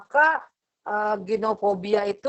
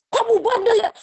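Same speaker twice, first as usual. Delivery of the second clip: mock scolding, much higher and louder; scolding parent; 12 semitones higher, 6 dB louder than usual. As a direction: snap at the child like an annoyed parent. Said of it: "Nggak langsung kita marah-marahin".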